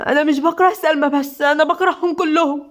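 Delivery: spiteful words through tears; tearful hatred. A narrator's reading. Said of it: "فيروز بغل وحقد ودموع". (بغل وحقد ودموع)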